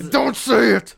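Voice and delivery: high, loud interrupting yell; hammed-up loud command; mock censoring shout. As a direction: cut in with a mock forbidding shout. Acts out the censoring world.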